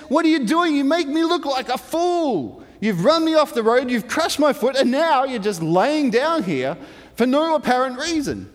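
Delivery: high-pitched, exasperated mock yell; angry shouting. Balaam so mad he shouts back at his donkey, ranting. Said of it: "Balaam just shouts back at his donkey".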